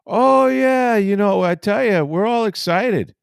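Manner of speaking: mock-excited manager imitation; Ozzie Guillen. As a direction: gush enthusiastically, hammed up. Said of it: "Ozzie Guillen walks up to me".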